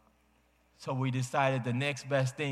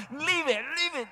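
put-on very high, squeaky child's demand; imitating child; 14 semitones higher than usual. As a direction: squeal it like a little boy grabbing a toy. Boy demands ball again.

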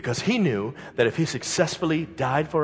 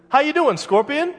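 cheery frog voice; 13 semitones higher, 6 dB louder than usual. Acted. Frog greets in fable.

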